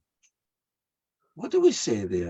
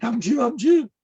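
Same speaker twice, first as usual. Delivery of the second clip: urgent; 11 semitones higher, 6 dB louder than usual. Retold or imitated. Granddaughter at hospital.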